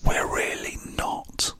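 slow, hushed whisper; whispered confession. Pretend. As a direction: whisper it like a secret. Hushed aside admitting they're unprofessional.

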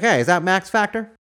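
put-on high, loud old-timey cop; 1930s beat cop. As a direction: bark it like a patrolling cop. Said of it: "Be like".

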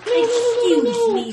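mock polite.

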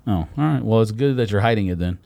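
ordinary delivery

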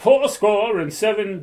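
high, drawn-out bad British accent; attempted British accent. Shown as a demonstration. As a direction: do a slow, badly faked British Lincoln. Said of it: "Wanna hear my British accent?".

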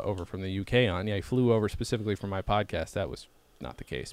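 normal talking voice

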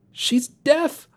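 very high squeaked question, rising; startled squeak. A narrator's reading. Shane echoes the news that the sister is deaf, squeaking. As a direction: squeak it in disbelief. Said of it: "I squeaked, as if I didn't know how".

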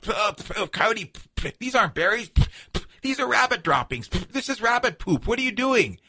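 high, sputtering disgusted Badger; trickster tale voices. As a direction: spit the words out in disgust. Badger spits out droppings.

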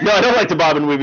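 ordinary talk, nothing marked

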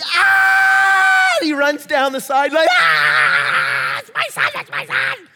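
high, loud joyful scream; proud father screaming into his selfie camera, high and loud; ecstatic screaming. Retold or imitated. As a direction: scream with pride as the ball goes in. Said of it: "he's like".

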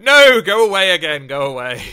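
loud, high "go away"; dismissive shout. Retold or imitated. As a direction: shoo him off loudly. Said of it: "And they went".

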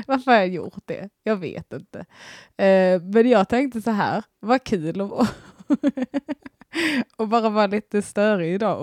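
ordinary talk

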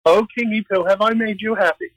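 high-pitched mock flattering Esther; coquettish act. Esther buttering up the tipsy king at her party, high-pitched put-on act. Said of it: "she goes through the act".

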